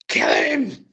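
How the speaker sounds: high-pitched mock yell of rage; retold rage. Worked-up fan yelling at the wrestlers, high mock rage. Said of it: "blowing, blowing rage out".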